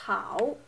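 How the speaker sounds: "how" dipping then rising; third tone. Shown as a demonstration. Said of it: "on the third tone as well".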